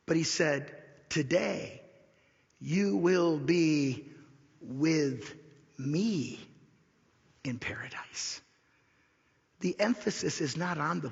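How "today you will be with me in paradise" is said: word by word, long pauses, fading at the end; deliberate quotation. Retold or imitated. Preacher stressing Jesus's words to the thief, slow and deliberate.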